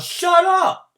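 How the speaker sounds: loud, high angry snap, slowing down; snarled. A narrator's reading. Starter Bump snapping at the noisy crowd, angry. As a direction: tell the crowd off. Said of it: "Bump turned and snarled".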